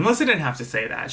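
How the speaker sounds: ordinary talk, nothing marked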